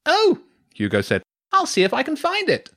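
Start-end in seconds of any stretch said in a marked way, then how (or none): 0.1-0.4 very high, loud oh; loud boy. A narrator's reading. Hugo hearing about the snake game, loudly. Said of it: "She really didn't want him shouting". 1.5-2.7 put-on high-pitched boy character voice. A narrator's reading. Hugo offering to find the computer game, high-voiced. Hugo's loud boy voice in the story.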